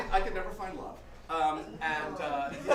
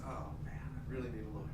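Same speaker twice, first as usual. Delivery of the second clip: lower, softer letdown; deflated fan; 3 semitones lower, 4 dB quieter than usual. Retold or imitated. Fan's hopes are dashed.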